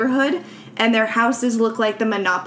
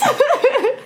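high burst of laughter. Real, not acted. Laughing at the joke.

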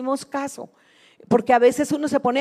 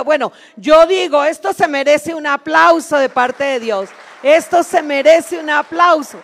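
louder, rousing sermon voice; fervent preaching; 3 semitones higher, 7 dB louder than usual. Acted. Urging the church to cling to God, fervent preaching. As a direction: fire up the congregation.

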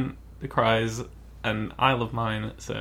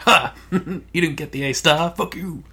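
gloating with a laugh, pitched up; mocking taunt; 4 semitones higher, 4 dB louder than usual. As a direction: crow it at the loser. [name] taunts Sally over her grade.

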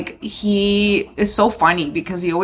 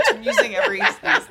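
high, giggling through the words; laughing; faster than usual. Real, not acted. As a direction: laugh it out as a joke. Joking list of excuses, laughing.

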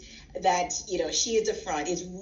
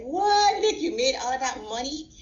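a mother reliving her shock at the money; retold surprise; 6 semitones higher than usual. Mother amazed at daughter's slime earnings, startled high. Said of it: "I was surprised at how much money".

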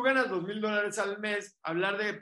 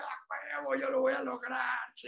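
fired-up vow; 5 semitones higher, 3 dB quieter than usual. Retold or imitated. Retold self fired up, crying.

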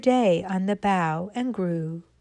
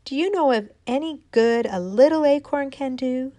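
acorn calling to the wind; 6 semitones higher, 4 dB louder than usual. Acted. Little acorn calling up to the north wind, pleading. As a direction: call up hopefully to the wind. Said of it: "she said".

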